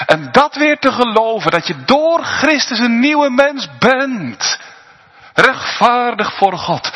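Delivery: high, loud, impassioned sermon climax; fervent preaching. Acted. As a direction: preach it out with rising fervor. Preaching builds to full force.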